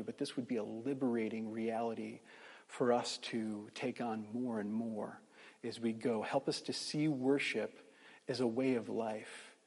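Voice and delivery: subdued, reverent praying voice; real closing prayer. Real, not acted. Pastor praying to the Father for the congregation, quiet and reverent.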